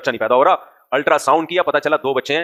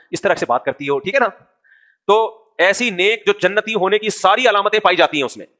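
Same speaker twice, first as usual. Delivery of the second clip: ordinary talk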